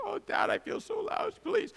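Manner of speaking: very high, squeaky mock pleading; whining child. Guilty child begging Dad for forgiveness, mock whine. Your child comes to you.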